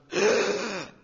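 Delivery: loud, sharp mock gasp; imitated gasp. Teacher acts out woman reading the chapter, gasping loudly. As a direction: gasp in sudden shock. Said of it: "she gasped".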